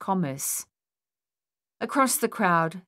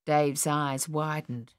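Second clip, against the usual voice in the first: ordinary delivery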